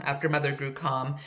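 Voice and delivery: ordinary delivery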